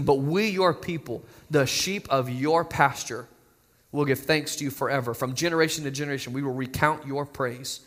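hushed closing scripture; reverent scripture reading. Real, not acted. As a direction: let the verse fade into quiet praise. Reads Psalm seventy-nine.

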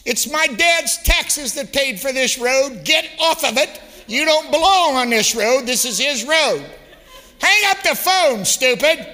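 high, loud shouted telling-off; shrill-free but loud mock tirade ending in a shout; child's angry scolding. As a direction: scold the driver like an outraged kid. Daughter scolding driver, retold.

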